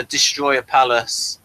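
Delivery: ordinary talk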